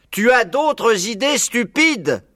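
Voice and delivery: high, slow, drawn-out shout; shouting to be heard. A narrator's reading. Buns repeating for deaf Bean, slow shout. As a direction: spell it out loudly, falling at the end. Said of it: "qui lui cria".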